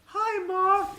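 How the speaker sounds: very high, sing-song sweet hello; high cooing imitation of a gentle friend; sweet mock greeting. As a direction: coo at the moth like a pet. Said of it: "Cisco's just like".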